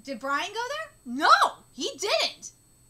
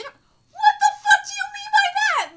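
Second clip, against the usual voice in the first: much higher, much louder crying shout; furious shout; 13 semitones higher, 11 dB louder than usual. Real, not acted. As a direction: explode at the insult. Furious reaction to the character.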